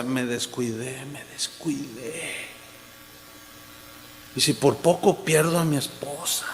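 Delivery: low, soft, slowed-down imitation of weeping regret; imitating tearful confession. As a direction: retell a broken man's confession, hushed. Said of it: "él lloraba y decía". (imitando un lamento lloroso, en voz baja)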